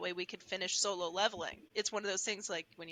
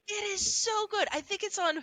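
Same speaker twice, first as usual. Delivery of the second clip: much higher, gushing praise; excited; 11 semitones higher, 4 dB louder than usual. Real, not acted. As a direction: rave about the show. Raving about a show.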